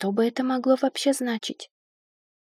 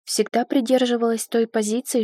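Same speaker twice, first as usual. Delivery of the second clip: usual speaking voice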